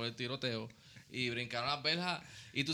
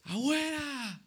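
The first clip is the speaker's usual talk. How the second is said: high alarmed shout; a high cry of "Abuela!" on seeing the news; calling out grandma; 11 semitones higher, much slower than usual. Retold or imitated. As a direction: yell for grandma in panic. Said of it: "Grité".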